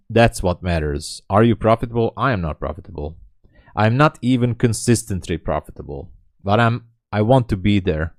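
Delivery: ordinary delivery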